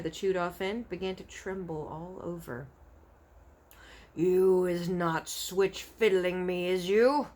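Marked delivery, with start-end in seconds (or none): 4.2-7.3 slow, louder, distrustful giant; suspicious brute giant. Acted. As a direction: squint at him with each word. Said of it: "said the blood bottler".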